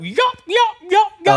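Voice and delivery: loud, high imitated turkey yelps; bird-like box call, sharply repeated; four-note yelp. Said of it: "Four note".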